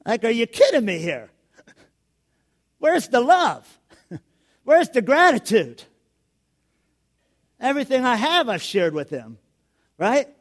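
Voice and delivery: loud, high, exaggerated complaint; hammed-up wounded father; mock outrage. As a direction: play up the wounded dad. Pretend outrage at son.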